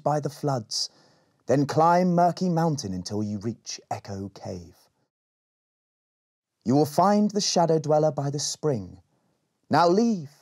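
put-on a sage sending children off; wise old Elderling. Elderling gives directions.

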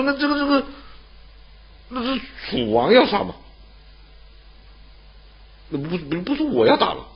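high, stammering excuse, caught out; flustered stammering Lu Ban. Acted. (被问住的鲁班，高声结巴地辩解)

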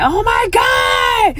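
high, slow mock-tearful wail; retold crying outburst. Retelling her meltdown over the car, mock tearful wail. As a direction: imitate yourself bawling in relief. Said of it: "like, crying, like".

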